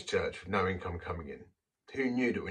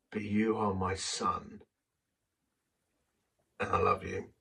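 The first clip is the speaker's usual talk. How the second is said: tender inner whisper. Retold or imitated. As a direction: let each word land gently like a father. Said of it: "this whisper in my heart said".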